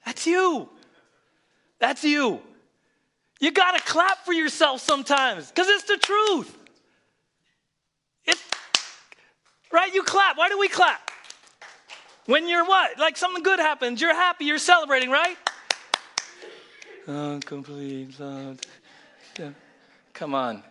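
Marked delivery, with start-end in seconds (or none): 0.1-15.4 high, loud, exhorting preacher. Acted. Preaches at full force. 17.1-19.5 slow, drawn-out monotone; mock unenthusiastic. Mimics a celebration without joy.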